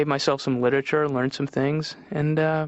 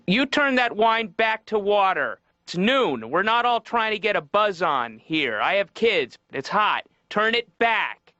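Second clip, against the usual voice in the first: high, scolding mother voice; scolding mom; 7 semitones higher, slower than usual. Retold or imitated. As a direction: nag like an embarrassed mom at a wedding. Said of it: "yelled at him in front of everybody".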